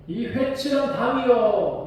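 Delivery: high, slow hurled insult; Paul yelling insult. Retold or imitated. Paul shouting an insult at the high priest. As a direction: hurl it at him, drawn out. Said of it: "더 크게 소리를 질러요".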